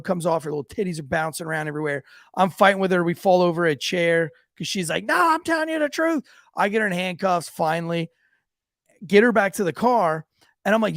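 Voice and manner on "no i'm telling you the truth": pleading woman. Retold or imitated. Woman pleading during arrest.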